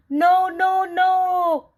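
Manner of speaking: high, loud shout of "no". A narrator's reading. The mayor shouting down at Chutney's seed. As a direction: shriek it from up high. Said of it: "looked down and shouted".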